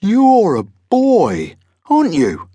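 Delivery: high, loud, drawn-out monster voice; astonished monster. A narrator's reading. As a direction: marvel slowly at the discovery. Monster realises he faces a boy.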